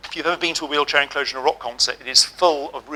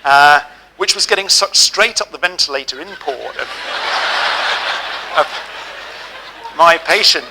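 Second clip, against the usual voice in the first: laughing; 3 semitones higher, 6 dB louder than usual. Real, not acted. Laughing at smoking-patient memory.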